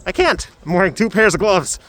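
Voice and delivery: exasperated exclaim. Real, not acted. Can't pull covering down, gloves.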